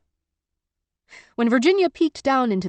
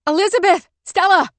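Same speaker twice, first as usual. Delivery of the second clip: loud, high calls one after another; frantic calling shout; shouting names; 11 semitones higher, 7 dB louder than usual. A narrator's reading. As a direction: keep yelling the missing names. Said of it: "she shouted".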